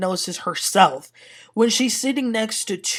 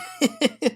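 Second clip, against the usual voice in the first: quick burst of laughter; short laugh; 6 semitones higher than usual. Real, not acted. Recapper laughing at Toya's reply. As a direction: laugh at the joke.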